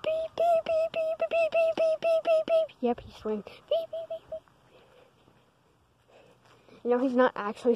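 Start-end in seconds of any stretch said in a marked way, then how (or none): 0.0-2.8 steady high sung beeps; censor beeps. Pretend. Kids covering dad's swearing with high beeps. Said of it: "we're just saying the word beep". 3.4-4.4 steady high sung beeps; censor beeps. Pretend. Kids covering dad's swearing with high beeps. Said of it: "we're just saying the word beep".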